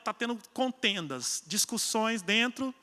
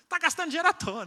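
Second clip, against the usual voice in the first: higher scolding complaint; mock scolding husband; 7 semitones higher, 3 dB louder, faster than usual. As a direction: tell her off for wasting money. Marido bravo encenado. (marido reclamando, bronca encenada)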